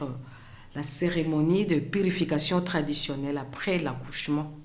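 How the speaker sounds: softly spoken survivor's testimony, long pauses between phrases; témoignage déclamé. Acted. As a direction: recount it quietly as the woman who lived it. (témoignage dit à voix basse, avec retenue)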